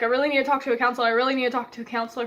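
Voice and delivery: very fast, urgent pleading; frantic plea to the office staff; panicked rush. Retold or imitated. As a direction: blurt it out in a panic.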